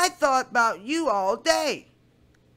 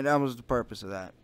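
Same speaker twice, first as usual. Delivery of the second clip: ordinary talk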